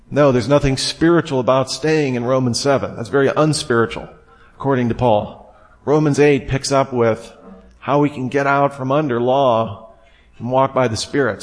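normal talking voice

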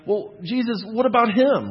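high, loud, pressing question about a friend; anxious question. Retold or imitated. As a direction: push the question up with worry. Peter anxiously asking about John.

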